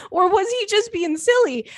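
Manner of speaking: way up high, giggly question; amused squeal. Real, not acted. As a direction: delight bubbling into the question. Amused guess about the husband.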